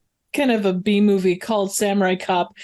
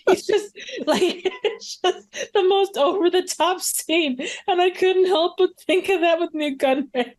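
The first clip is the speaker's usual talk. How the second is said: laughing; 8 semitones higher than usual. Real, not acted. Laughing through the retelling.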